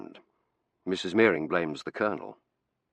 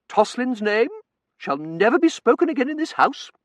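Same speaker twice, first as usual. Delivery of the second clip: stern grieving mother; 7 dB louder than usual. Acted. Mother renounces eloping daughter.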